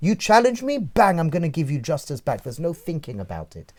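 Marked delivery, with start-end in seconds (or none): 0.1-2.4 impulsive attack. Acted. The animal self answering whoever challenged it, impulsive attack. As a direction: hit back at once, punchy and emphatic.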